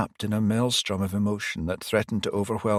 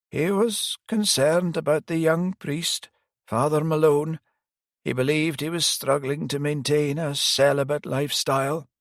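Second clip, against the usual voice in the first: put-on higher old priest voice, rueful; elderly priest; 8 semitones higher than usual. Priest admitting he failed the detective, higher pitched.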